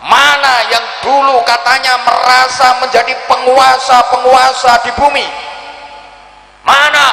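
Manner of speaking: high loud demanding shout; challenging shout. Acted. Preacher shouting God's challenge to rulers.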